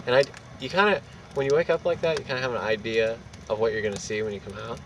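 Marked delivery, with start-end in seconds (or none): none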